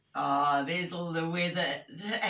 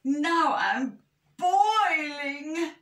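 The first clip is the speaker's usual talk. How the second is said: high, very slow wail of complaint; hammed-up overheated bear; moaning; 12 semitones higher, 7 dB louder, much slower than usual. A narrator's reading. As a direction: drag it out in a high moan. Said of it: "moaned Big Bear".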